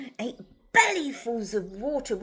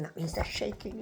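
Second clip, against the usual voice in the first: usual speaking voice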